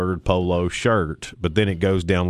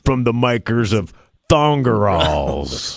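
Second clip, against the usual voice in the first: ad announcer; slower than usual. Acted. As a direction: boom it out like a TV commercial. Mock ad announcement.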